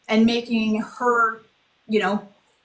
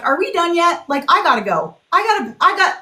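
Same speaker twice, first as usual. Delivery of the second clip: higher-pitched retold impatience; exasperated mock hurry to get away; impatient brush-off; 7 semitones higher, 4 dB louder than usual. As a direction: act out being itching to leave, pitch jumping up. Retold impatient brush-off of crying men.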